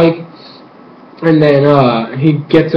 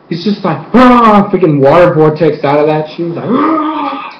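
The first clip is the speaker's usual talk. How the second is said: ordinary talk, nothing marked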